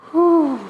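high-pitched puff of discomfort; acted tight-suit exhale; strained whew. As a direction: let out a squeezed, high whew. Reacting to the tight wetsuit.

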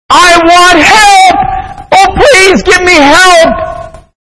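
high, screamed cry for help; desperate plea. Acted. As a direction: beg at the top of your voice, drawn out. Dangling man pleads for his life.

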